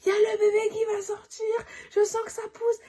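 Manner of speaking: high crying cry of alarm. Retold or imitated. To the midwife at the door, a high crying shout. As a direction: wail out that the baby is coming. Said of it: "je crie".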